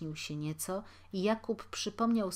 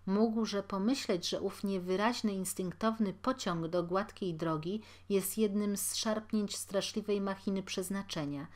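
usual speaking voice

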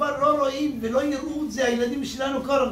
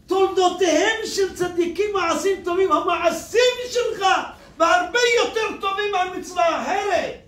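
raised, ringing sermon voice; rousing reassurance; 7 semitones higher than usual. Acted. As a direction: lift him up with conviction. Rabbi comforting the weeping man.

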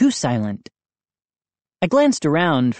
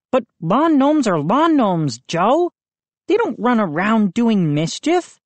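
lightly voiced boy, a touch higher; worried friend explaining, lightly played; boy Moose; 8 semitones higher than usual. A narrator's reading.

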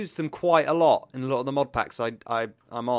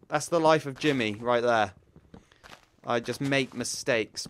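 ordinary talk, nothing marked

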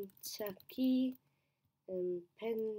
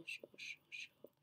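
whispered; 12 dB quieter than usual. Real, not acted. Muttering while placing states.

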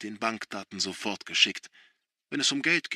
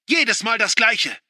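much higher, louder, slow yell; screaming back; 11 semitones higher, 9 dB louder than usual. A narrator's reading. Son screaming back at father. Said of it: "schreit Josh zurück".

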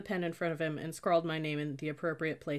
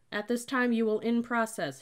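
raised, higher official announcement; loud and confident; 6 semitones higher, 5 dB louder than usual. A narrator's reading. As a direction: instruct recruits firmly without fierceness. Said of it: "His voice was loud and confident".

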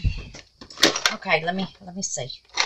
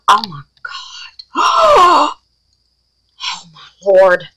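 amazed outburst; 3 dB louder, much slower than usual. Real, not acted. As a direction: draw it out in astonishment. Astonished by mixed colour.

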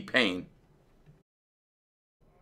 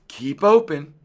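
higher, raised-voice order; mild yell; 6 semitones higher, 3 dB louder than usual. A narrator's reading. Dentist standing in the fox's mouth, mild yell. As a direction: call out an urgent command. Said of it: "yelled Doctor DeSoto".

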